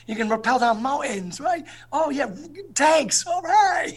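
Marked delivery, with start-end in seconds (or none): 1.9-3.9 high, loud whoop; excited cheer. Retold or imitated. As the recruit dreaming of tanks, whooping higher and louder. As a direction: cheer like an eager young recruit. Mocking eager recruit enthusiasm.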